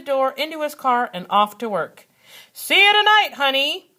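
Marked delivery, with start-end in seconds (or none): 2.5-3.9 calling out. A narrator's reading. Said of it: "he called out".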